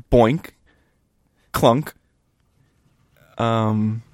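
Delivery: ordinary delivery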